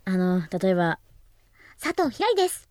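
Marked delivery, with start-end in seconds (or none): 1.8-2.7 put-on very high cute anime girl voice. Shown as a demonstration. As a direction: chirp it like an anime heroine. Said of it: "みたいなアニメ声も出ます".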